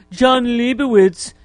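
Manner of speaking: slow, drawn-out Trump voice; mocking Trump's sneer; Trump impression. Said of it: "remember he'd say".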